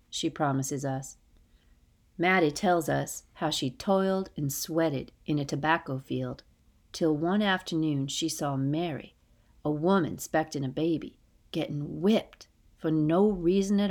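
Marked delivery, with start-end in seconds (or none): none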